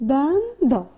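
دندان with first half stretched and rising, second half dropping; split into syllables. Shown as a demonstration. Teacher showing two parts to pupils, دندان split by syllable. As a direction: break دندان into two beats. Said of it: "کلمهٔ دندان دو بخش داره".

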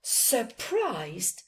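plain reading voice

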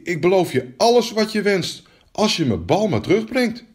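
put-on eager young princess's promise.

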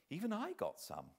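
usual speaking voice

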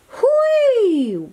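high gleeful whee; excited cry. A narrator's reading. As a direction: squeal with delight. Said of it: "Woody cried".

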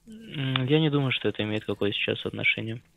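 normal talking voice

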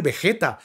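Vegeta said be-JE-ta; Spanish J sound. Shown as a demonstration. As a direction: rhyme Vegeta with jeta. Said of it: "la G E sin U es Vegeta".

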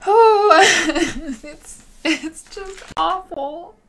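high, teary voice breaking into laughs between sobs; crying and laughing at once over lost beauty; real crying. Real, not acted. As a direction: voice cracking with real tears. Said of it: "Oh, I'm gonna cry".

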